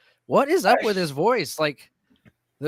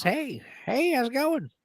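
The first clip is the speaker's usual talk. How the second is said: high-pitched put-on uncle voice; imitated high folksy greeting; Uncle Dickie; 8 semitones higher than usual. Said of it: "he talks like this".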